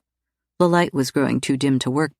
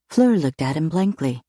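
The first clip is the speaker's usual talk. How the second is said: ordinary delivery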